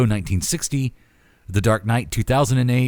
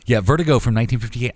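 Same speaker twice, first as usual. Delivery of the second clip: normal talking voice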